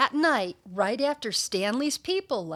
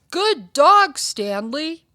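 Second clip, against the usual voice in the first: loud, high praise for a dog; owners praising their dog; 5 semitones higher, 10 dB louder than usual. Acted. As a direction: call out a hearty good dog. Owners greet their dog in story.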